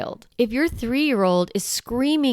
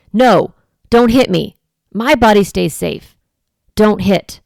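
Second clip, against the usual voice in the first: firm voice; 6 dB louder than usual. Shown as a demonstration. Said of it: "using that firm voice".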